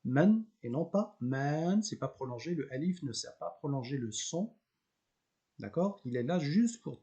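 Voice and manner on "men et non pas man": "men" said short, not drawn out as "man". Shown as a demonstration. Teacher contrasts "men" and wrong "man", slow. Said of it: "retenez bien que men, ça se prononce".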